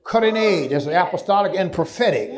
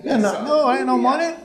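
laughing preacher's jab; 7 semitones higher than usual. Acted.

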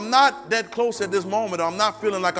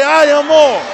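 shouted louder, higher; 8 semitones higher, 14 dB louder, slower than usual. Shown as a demonstration. Congregation urged to repeat it louder, shouting. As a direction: give it more volume. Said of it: "Say it a little louder".

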